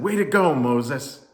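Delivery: loud, high crowd cheer. A narrator's reading. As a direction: cheer it out to the hero. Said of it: "the people cheered and said".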